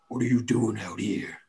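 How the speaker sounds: lightly voiced crude orc; crude puzzled orc. Orc questions Jarzak at the camp, mildly puzzled. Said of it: "in, like, a very crude kind of putting pieces together kind of way".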